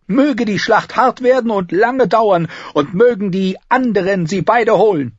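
high, loud, declamatory; gleeful toast. Acted. Cersei toasting her rivals' ruin, gleeful. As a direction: proclaim the wish with relish.

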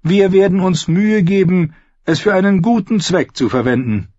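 high, smooth mock-polite outlaw singer; sweetly polite while threatening; silky false courtesy. Singer figure voiced.

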